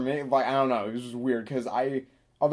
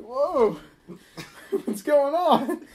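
high-pitched startled retelling, half laughing; mock terrified; 11 semitones higher, much slower than usual. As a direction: play startled alarm, high and amused. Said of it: "I'm, like, terrified".

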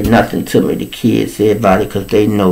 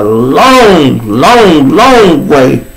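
each "long" stretched and swooping up; "long, long, long" drawn out with rising force; drawn-out emphasis; 4 semitones higher, 5 dB louder, much slower than usual. Real, not acted. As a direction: lean hard and slow on every "long". Stretches "long" to stress the distance.

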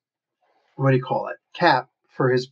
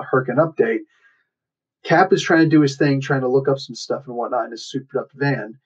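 usual speaking voice

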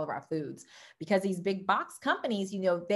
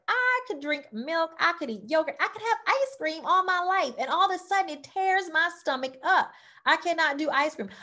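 mock whiny complainer; 9 semitones higher than usual. Person lamenting they can't eat ice cream anymore, in a higher mock-whiny voice. Voicing a typical food-sensitive person.

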